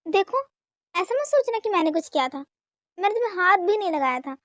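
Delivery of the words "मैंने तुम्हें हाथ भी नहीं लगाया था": ordinary talk, nothing marked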